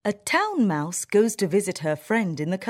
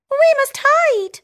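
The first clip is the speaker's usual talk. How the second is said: very high, loud squeaky cry; shouting mouse; 9 dB louder than usual. Acted. Town mouse warning her friend of the people, loud high squeak. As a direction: sound the alarm in a tiny voice. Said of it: "shouts the town mouse".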